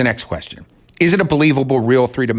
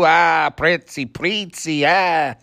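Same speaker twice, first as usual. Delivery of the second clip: put-on Nicholson's Italian accent; 7 semitones higher, much slower than usual. Reviewer parodying Nicholson's Italian accent, slow and higher. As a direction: lampoon a bad Italian accent, dragging it out. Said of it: "Jack Nicholson doing up an Italian".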